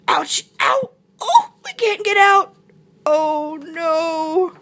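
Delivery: high, yelping monkey voice; monkey in pain. A narrator's reading. Monkey crashed into the hole, high pained wail. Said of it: "Groaned Monkey".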